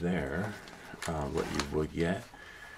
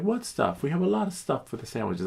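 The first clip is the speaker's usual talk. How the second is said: lightly voiced clerk, high; high mock clerk; 8 semitones higher than usual. The clerk quizzing the customer, high mock. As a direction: toss the question back. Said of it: "He's like".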